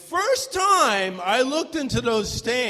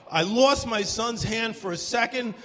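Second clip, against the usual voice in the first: ordinary delivery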